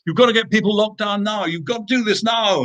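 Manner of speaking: high, insistent urging; pleading with the government to act now; retold exasperated demand, pitched high; urgent plea. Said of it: "hitting the table at home saying".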